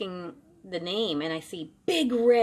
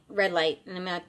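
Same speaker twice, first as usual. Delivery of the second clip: normal talking voice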